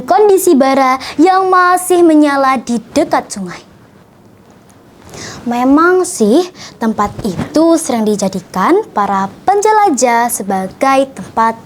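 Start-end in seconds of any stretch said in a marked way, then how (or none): none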